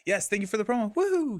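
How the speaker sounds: high-pitched thanks rising into a whoop; excited. Real, not acted. Streamer cheering a viewer who got the promo, excited. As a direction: celebrate for them, pitch leaping at the end. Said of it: "I'm so excited you got it".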